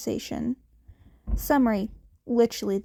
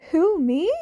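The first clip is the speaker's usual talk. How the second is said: innocent; 5 semitones higher, 5 dB louder than usual. Acted. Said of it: "made an innocent".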